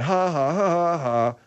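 high fake ha-ha; imitated mocking laugh. Accusing Bud of needling people, mocking imitation. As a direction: mimic a laugh meant to annoy. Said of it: "to piss people off".

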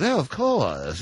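put-on voice of a gushing London host; mock eager-to-please restaurateur; fawning host. London host to the embassy caller, mock fawning. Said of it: "they just start drooling over themselves".

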